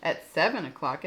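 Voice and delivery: usual speaking voice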